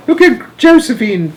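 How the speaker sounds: high, loud exclamation; alarmed cry. A narrator's reading. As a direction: cry out in horror for them to look. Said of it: "cried Grandpa Joe".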